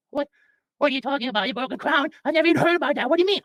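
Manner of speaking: high, puzzled falsetto nurse; high-pitched comic nurse; nurse character. Acted. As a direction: question the patient in disbelief, up high. Nurse questions Jack.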